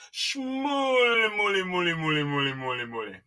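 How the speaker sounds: playful name babble. Pretend. Host toying with the backer's name Shmuley, sliding singsong babble. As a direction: roll the name into a descending, fading chant.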